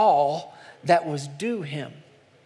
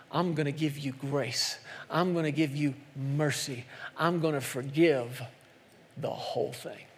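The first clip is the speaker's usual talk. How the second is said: tender forgiveness; 4 semitones lower, 8 dB quieter than usual. Acted. King gently forgiving his servant, softly. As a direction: let mercy pour out quietly.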